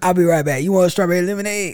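hammed-up drive-thru cheer; a sugary cashier offering lemonade; mock sweet service voice. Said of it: "playing polite Chick-fil-A worker".

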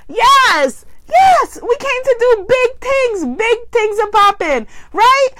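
excited rally. Real, not acted. High excited affirmation.

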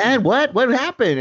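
high, insistent mock-impatient father; exasperated dad. Dad cutting off son's long story, exasperated. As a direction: imitate a dad cutting in on a rambling son. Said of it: "finally the dad's just exasperated".

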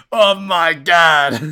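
loud, very drawn-out anime exclamation; Joseph Joestar. Retold or imitated. Fan mimicking a Japanese voice actor's English line, slow hammy shout. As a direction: stretch every word in a hammy shout. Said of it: "see him go like".

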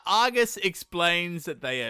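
ordinary talk, nothing marked

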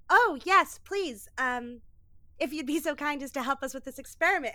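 high, loud burst of hammed-up courtesy, rushing on fast; bow and scrape, overplaying the politeness; over the top. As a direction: ensign overacting gracious welcome to the hologram, hammy and fast. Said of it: "Michael Shannoning the whole scenery".

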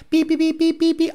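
high mock beeping; high chirpy beep beep; SOS beeps. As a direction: beep like a distress signal. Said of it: "They're like".

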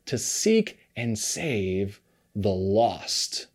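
slow emphasis. Acted. Key verse drawn out.

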